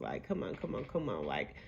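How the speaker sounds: ordinary talk, nothing marked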